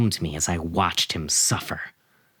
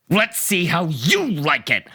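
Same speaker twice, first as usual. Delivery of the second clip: screaming; 7 semitones higher than usual. A narrator's reading. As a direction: scream it at him spitefully. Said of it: "I screamed".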